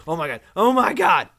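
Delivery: loud high yelp; startled shout. Real, not acted. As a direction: cry out in shock. Sudden fright.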